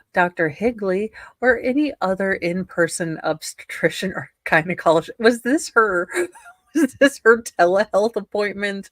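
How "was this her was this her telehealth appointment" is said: words broken by laughter, louder; can't keep a straight face while asking; laughing. Real, not acted. Host reading court text to co-host, laughing.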